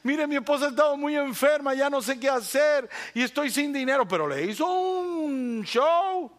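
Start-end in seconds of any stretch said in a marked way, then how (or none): 0.0-4.1 a hint of a pleading stranger; lightly voiced desperate man asking for cash; begging man. Pastor voices the beggar pleading with the lady. Man begs woman for money.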